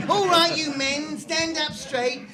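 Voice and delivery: high, loud parade-ground bark; stiff barking toy soldier. Acted. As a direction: bark orders like a drill sergeant. Sergeant drills the men.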